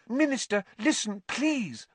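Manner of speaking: high imploring plea. A narrator's reading. Girl begging the Minister to listen, imploring. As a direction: implore him, drawing out the final word. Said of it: "gazing imploringly into Fudge's face".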